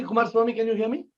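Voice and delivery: usual speaking voice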